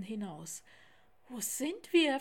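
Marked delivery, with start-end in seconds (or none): 1.3-2.2 raised, louder anxious little tiger; anxious question. A narrator's reading. Theo asking Lars where they are, mildly anxious. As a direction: ask it with a touch of fear. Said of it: "fragte Theo ängstlich".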